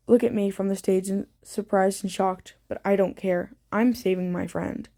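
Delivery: plain reading voice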